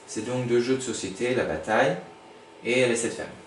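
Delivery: ordinary talk, nothing marked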